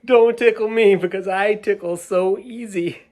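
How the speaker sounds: put-on high, loud squeal of a ticklish kid; giggly ticklish voice. Ticklish marchers pleading not to be tickled, squealing. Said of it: "they were saying".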